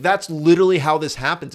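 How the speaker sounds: normal talking voice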